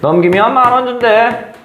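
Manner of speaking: slyly fishing, drawn out at the end; sly probing. Shown as a demonstration. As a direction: sound him out casually, trailing off slow. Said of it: "이렇게 슬쩍 떠봅니다".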